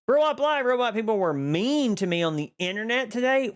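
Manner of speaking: whiny complaint to a friend; mock whine. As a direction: tattle like a wronged kid. Whining to a chatter.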